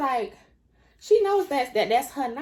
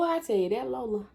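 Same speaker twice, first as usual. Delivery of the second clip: ordinary talk, nothing marked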